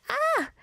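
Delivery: retold high "ah!"; mock yell. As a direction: imitate a short childhood yell of pain. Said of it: "and I yelled out".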